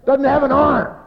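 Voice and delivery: high, loud mock wail; hammed-up tearful outcry; crying, imitated. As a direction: blurt it out as a distraught wail. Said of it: "Guy came to me and he's crying".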